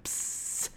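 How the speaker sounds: sharp sizzling hiss; imitated sizzle. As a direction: make the sound of butter hitting a hot pan. Imitating butter sizzling on pan.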